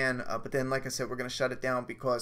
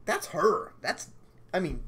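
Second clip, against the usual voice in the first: ordinary talk, nothing marked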